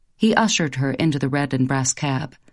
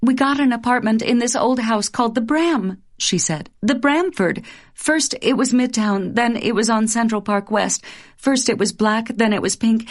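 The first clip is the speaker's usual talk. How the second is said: high, lively recounting; higher, animated storytelling voice; chattering young wife; 6 semitones higher than usual. Acted. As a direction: tumble out the dream details. Wife recounts dream flat.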